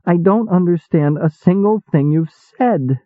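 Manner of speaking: helpless, about to cry; small pitiful voice. A narrator's reading. Apprentice confessing confusion to Oz, near tears. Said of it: "pitiful voice I hardly recognized".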